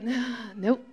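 low, soft mock-casual brush-off; offhand denial. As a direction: play it down quietly, as if not bothered. Her own dismissive reply, retold.

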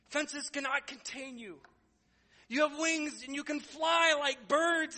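high, loud, slow hammed-up preacher; duck pastor preaching. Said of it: "And he says this".

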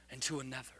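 normal talking voice

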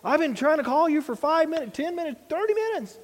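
hollering parent. Acted. Parent calling a kid in from a friend's house, hollering. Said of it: "your parent is out there hollering your name".

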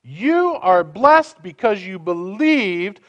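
solemn scripture read, slowed down. Acted. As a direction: proclaim it as holy speech. Reads Elizabeth's prophecy.

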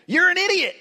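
sudden high, loud yell of "idiot"; road rage yell. Acted. Driver braking hard yells at the other car. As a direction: shout it at the car that cut you off.

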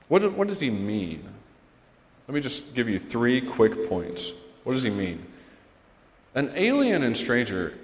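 loud, driving sermon delivery, pressing each point home; fervent preaching. Real, not acted. Pastor urging the congregation about aliens and strangers, preaching loud. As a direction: preach it out to the congregation with full force.

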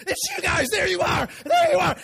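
high, loud excited shout; hallway yelling, thrilled; excited screaming. Retold or imitated. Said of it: "screaming at us".

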